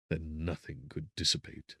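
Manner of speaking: ordinary delivery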